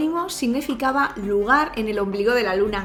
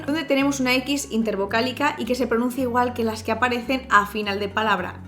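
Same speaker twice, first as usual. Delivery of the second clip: ordinary talk, nothing marked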